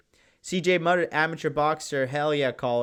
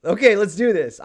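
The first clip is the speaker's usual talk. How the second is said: eager, raised voice itching for a fight; louder, higher, mock-eager dare; acted thrilled challenge; 6 semitones higher, 7 dB louder than usual. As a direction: play delighted at being threatened. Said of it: "I'm, like, thrilled".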